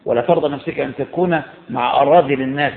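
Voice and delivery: usual speaking voice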